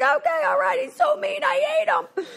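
very high, squeaky mock whine; whiny high complaint. Griping to her sponsor about her husband in a high whine. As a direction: squeal it like a sulky kid. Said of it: "I was like".

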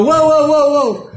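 high, loud startled cry that falls away; startled whoa. Retold or imitated. Walking into the wrong bathroom, a startled retold cry. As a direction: caught off guard.

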